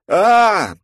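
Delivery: loud high scream; yell. Acted. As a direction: scream as you are yanked away. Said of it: "это я воплю".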